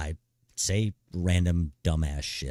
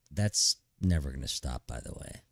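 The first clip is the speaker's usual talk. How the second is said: usual speaking voice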